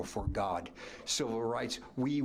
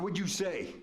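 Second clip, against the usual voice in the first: sharp challenge; 8 semitones higher than usual. Retold or imitated. Coach wheels on the runner.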